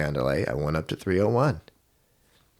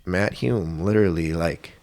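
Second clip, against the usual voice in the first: ordinary delivery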